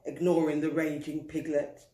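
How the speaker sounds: usual speaking voice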